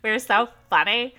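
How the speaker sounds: preen like you think you're the funniest; smug show-off voice. Pretend. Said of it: "I don't know why I'm talking like that".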